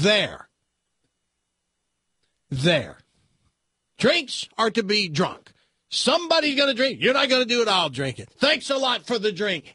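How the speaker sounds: high mock-tough biker taunt. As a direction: gloat like a bully who just drank it. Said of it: "And goes".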